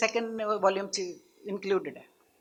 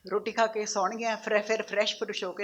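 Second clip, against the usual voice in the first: ordinary talk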